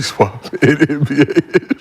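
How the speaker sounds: real laughter breaking up the line; trying to finish the joke while cracking up; laughing through words. Real, not acted. Host cracks up repeating his joke.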